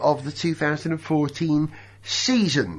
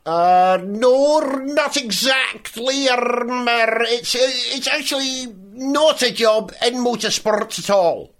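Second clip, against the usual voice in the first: put-on Alan McNish in a Scottish accent; lightly voiced Scottish racing driver; 4 semitones higher than usual. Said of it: "what else would a dashing Scotsman do".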